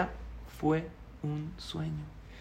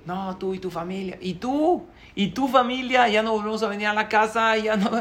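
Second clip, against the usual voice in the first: loud, much higher furious comeback; imagined angry retort; 6 semitones higher, 7 dB louder than usual. Pretend. The groom imagined snapping back at his mother-in-law, loud angry retort. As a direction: blow up at the in-laws.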